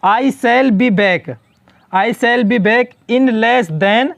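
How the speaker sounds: "I shall be back" read out very slowly, word by word; slow dictation read. Shown as a demonstration. Teacher reading the example sentence aloud to the class, very slow. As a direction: read it slowly enough for students to follow. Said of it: "next देखो यहाँ पे".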